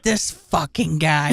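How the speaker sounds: low, slow exasperation; weary guards. Acted. Two guards watching the prisoner, low and slow weary disbelief. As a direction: drop low and drag it out, unimpressed. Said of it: "two guards are just like".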